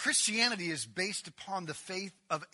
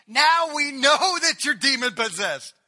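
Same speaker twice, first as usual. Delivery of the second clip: loud, high triumphant accusation; loud exclamation; 8 semitones higher, 15 dB louder than usual. Acted. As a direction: shout it like you've caught him out. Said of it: "At this they exclaimed".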